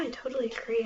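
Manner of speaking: ordinary delivery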